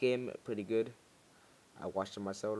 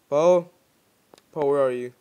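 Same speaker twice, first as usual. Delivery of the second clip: calling out; 10 dB louder than usual. Real, not acted. Calling out for Poe.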